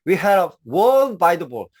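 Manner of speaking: slow, spaced-out "We had a wall by the ball"; teacher reading the idiom very slowly; very slow demo. Shown as a demonstration. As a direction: lay out each word slowly for students. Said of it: "여러분 보세요".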